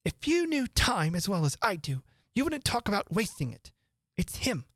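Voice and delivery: a touch of the know-all Hatter; lightly voiced Hatter. A narrator's reading. Hatter lecturing Alice about time, lightly voiced.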